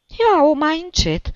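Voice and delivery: high, loud, drawn-out indignation; old woman taking loud offence; slow, high-pitched affronted cry; offended old woman. A narrator's reading. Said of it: "se supără bătrâna".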